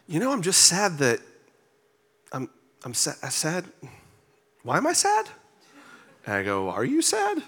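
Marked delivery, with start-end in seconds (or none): 0.1-5.3 higher-pitched imitation of a puzzled wife; halting, then rising high in mock bewilderment. Wife looks up from the table, imitated as puzzled and higher-pitched. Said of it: "she just looked up and she goes". 6.7-7.4 high-pitched, mock-puzzled asking; puzzled question. Husband questioning his wife on the steps, retold as high-pitched puzzlement.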